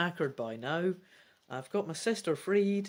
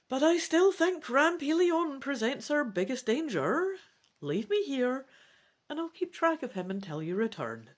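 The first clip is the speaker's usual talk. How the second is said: lightly voiced dragon, a bit higher; grudging agreement in a character voice; a hint of a young dragon giving in; Defal the dragon; 9 semitones higher, 3 dB louder than usual. Defal speaks again.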